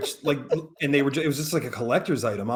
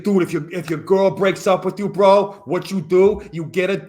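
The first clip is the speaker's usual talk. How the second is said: imitated roommate in a Long Island accent; Long Island bro accent; 2 semitones higher than usual. Imitating college roommate Steve's breakup advice, in a Long Island accent. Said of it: "Long Island talked like that".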